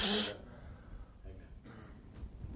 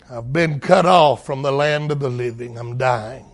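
soft, low, trailing last breath; whispered dying words; fading dying voice; 6 semitones lower, 5 dB quieter than usual. Acted. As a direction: let it die away. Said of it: "he says here".

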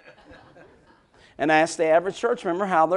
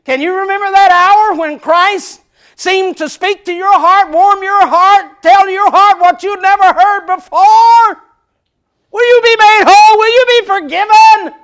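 full-force preaching; 13 semitones higher, 15 dB louder than usual. Acted. Preacher calling the congregation, loud and rising. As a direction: build it to a shouted altar call.

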